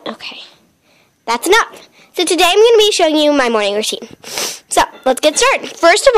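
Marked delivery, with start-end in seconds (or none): none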